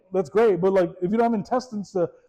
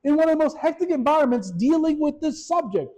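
exasperated; 8 semitones higher than usual. Retold or imitated. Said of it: "that's all I could think is".

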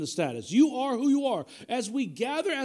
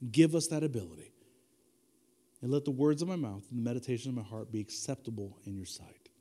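prayer; 6 semitones lower than usual. Acted. Opening prayer.

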